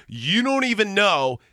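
a hint of drunken insistence; intoxicated. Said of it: "So a very intoxicated".

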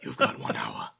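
Walken voice. Acted. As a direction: drop way down, Walken style. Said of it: "as Christopher Walken".

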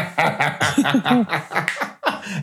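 laughing. Real, not acted. Laughing at dance joke.

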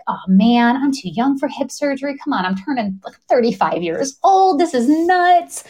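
exasperated disbelief. Retold or imitated. Patient told of a hip tear at thirty-five, exasperated disbelief. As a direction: whine in disbelief at the news.

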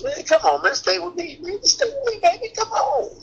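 very high begging voice; high pleading Curry. Retold or imitated. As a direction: plead high, near falsetto. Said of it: "I love this character".

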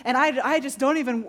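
ordinary talk

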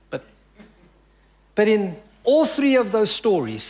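ordinary delivery

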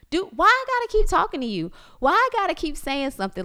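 put-on high, fast, loud scold at the kids; fed-up mom snapping at her children; rapid-fire exasperated rant. Scolding kids in grocery store.

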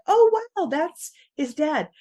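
high, amazed gasp of realization; surprised. Retold or imitated. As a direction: squeal it out in shock. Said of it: "your moment of being like".

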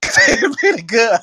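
high, slow words through laughter; laughing drawn-out delivery. Real, not acted. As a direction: say it while cracking up. Laughing at the sex tape talk.

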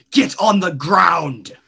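loud, slow shouted order; shouted command. Acted.

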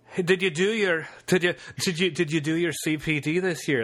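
badly faked Welsh accent. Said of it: "That's my bad Welsh accent".

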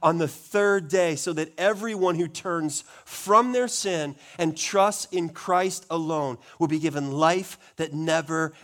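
loud, ringing gospel preaching. Acted. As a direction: preach it out to the congregation. Preaching the gospel at full force.

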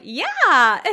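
loud, drawn-out cheerful yeah; oddly cheerful. Acted. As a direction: chirp it out loud, oddly upbeat. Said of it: "they're oddly cheerful".

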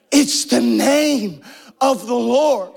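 slow, weighty repetition; slow solemn. Real, not acted. Preacher repeating the name to the church, slow and solemn. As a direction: lay each word down slowly. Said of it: "so emphatic about calling the name".

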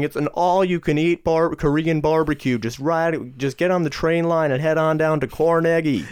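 high, hammed-up New York guy; exaggerated New York coworker talking up a plan; New Yorker impression. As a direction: invite like a mock New Yorker. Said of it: "this new guy from New York".

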